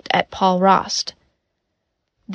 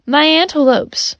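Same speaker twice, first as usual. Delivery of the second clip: higher-pitched cry over lost antelopes; narrated shout, understated and raised in pitch; mild quoted shout; 6 semitones higher than usual. A narrator's reading. Doctor mimicking her patient's cry to the gunman, mild shout. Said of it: "falls to the ground shouting".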